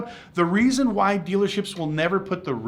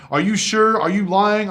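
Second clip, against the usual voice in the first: louder, higher, suspicious demanding questions; loud doubting caller; 4 semitones higher, 7 dB louder than usual. Retold or imitated. A caller grills the dealer over the advertised price, loud and suspicious. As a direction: grill him like a caller who smells a lie. Caller beating him up on phone.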